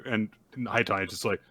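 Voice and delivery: normal talking voice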